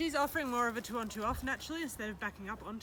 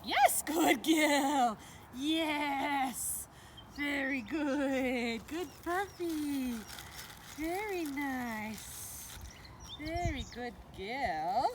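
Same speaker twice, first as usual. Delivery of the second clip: praise; 3 semitones higher, much slower than usual. Real, not acted. Praising dog for backing up.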